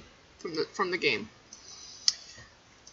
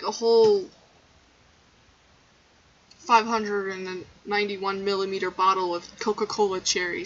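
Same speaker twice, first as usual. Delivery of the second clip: normal talking voice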